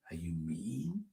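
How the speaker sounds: hushed whisper, rising; whispered question. Pretend. Host whispering the unkind option to children, hushed.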